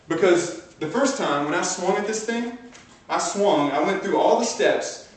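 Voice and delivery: rising fired-up energy; pumped up. Real, not acted. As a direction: coach getting the room hyped. Pumped-up retelling of his swing.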